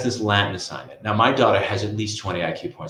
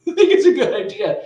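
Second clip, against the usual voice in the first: laughing; 14 semitones higher, 9 dB louder than usual. Real, not acted. Laughs at own absurd idea.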